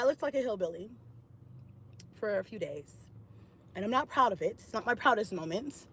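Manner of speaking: soft, embarrassed admission; quiet sheepish. Real, not acted. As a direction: drop the voice, a bit ashamed. Sheepish confession, much quieter.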